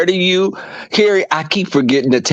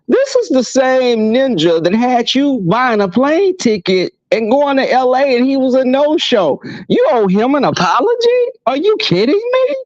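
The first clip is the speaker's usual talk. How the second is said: higher, building to an incredulous peak; rising disbelief; 7 semitones higher than usual. Retold or imitated. Guest retelling her comeback to a friend, incredulous. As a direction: get more outraged to the end. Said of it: "I'm like".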